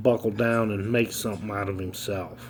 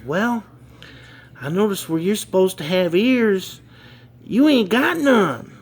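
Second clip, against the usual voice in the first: put-on kid voice; 8 semitones higher than usual. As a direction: answer innocently, pitched up like a boy. Second kid answers.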